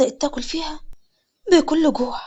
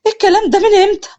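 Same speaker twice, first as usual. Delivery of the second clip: high, loud panicked question; alarmed demand; 7 semitones higher, 6 dB louder than usual. A narrator's reading. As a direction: demand it urgently from the brother. Sister interrogates brother. (بزعيق وقلق)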